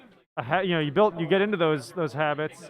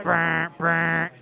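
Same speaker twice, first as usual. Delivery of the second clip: alarm buzz imitation. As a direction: mouth alarm buzzer. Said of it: "I need a sound effect".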